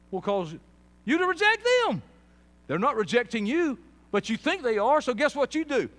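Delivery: high, loud preaching at full force. Real, not acted. Preacher confronting people about their own rejection, shouting. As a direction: shout the point out to the room.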